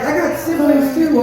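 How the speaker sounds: ordinary delivery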